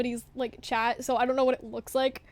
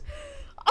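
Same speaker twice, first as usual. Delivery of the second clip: high nervous laugh; 14 semitones higher, 13 dB quieter than usual. Real, not acted. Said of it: "I'm gonna cry".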